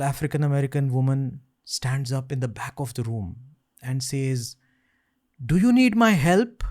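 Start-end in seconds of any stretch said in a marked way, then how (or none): 5.4-6.6 loud caller. Retold or imitated. Tall woman calls from back.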